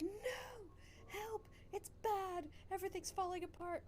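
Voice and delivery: high-pitched mock panic; panicking stream alert. Stream warning crying out to streamer, mock panic. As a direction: cry for help in hammed-up alarm. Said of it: "it's this performance on my stream, and it's like".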